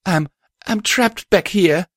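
high, small filly voice; high-pitched, hesitant child-like pony; tiny voice. A narrator's reading. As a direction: stammer it as a trapped little filly. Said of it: "a tiny voice called out".

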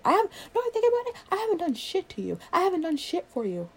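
high, heated protest; indignant. Acted. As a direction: voice shoots up in outrage. Acted quarrel, indignant protest.

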